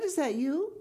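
high-pitched incredulous question; puzzled caller. Acted. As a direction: ask down the phone, baffled. Puzzled caller checks who answers.

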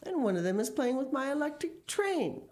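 put-on high-pitched child's complaint; aggrieved, voice climbing high; indignant cry. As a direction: cry out as a wronged little boy. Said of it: "cried Philip".

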